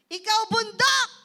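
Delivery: loud, high, forceful command; commanding shout. Shown as a demonstration. Preacher demonstrates commanding the mountain, loud authoritative shout. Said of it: "Dapat ganun".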